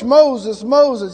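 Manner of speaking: loud booming call from the bush; a voice calling from a burning bush; god calling out. Acted. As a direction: call his name out loud, twice.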